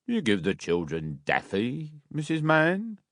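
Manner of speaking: a hint of a pompous beadle. A narrator's reading. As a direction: Bumble questions Mrs Mann about the daffy. Bumble questioning.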